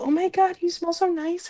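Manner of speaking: mock sweet flirt. Showing a friend how easy flirting is, soft mock coo. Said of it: "come up with a simple".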